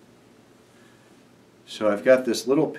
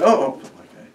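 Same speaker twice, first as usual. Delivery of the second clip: higher, louder fake startled "oh, oh"; mock surprise; 10 semitones higher, 8 dB louder than usual. Magician pretends the kids caught him, mock surprise. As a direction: pretend you've been caught out.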